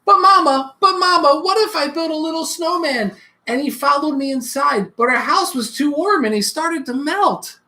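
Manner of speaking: put-on eager little child calling Mama; a child pestering with questions; small child full of what-ifs; curious child.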